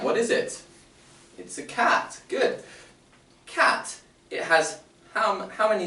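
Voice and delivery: teacher voice. Shown as a demonstration. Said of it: "teacher addressing children".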